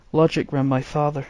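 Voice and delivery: usual speaking voice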